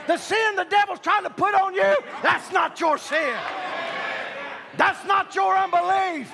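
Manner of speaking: high, shouted revival preaching; full-force preaching. Real, not acted. Preacher rousing the church against the devil, full-force preaching. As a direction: preach it hard, phrase by punched phrase.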